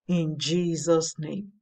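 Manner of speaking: normal talking voice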